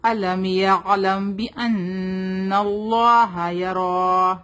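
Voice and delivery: slow measured tajweed recitation; teacher's model recitation; Quranic recitation. Shown as a demonstration. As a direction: recite it slowly, drawing out the vowels.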